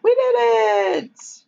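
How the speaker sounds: high-pitched little mock cheer; imitated boy's cheer. Boy on raft as it sails out, high imitated cheer.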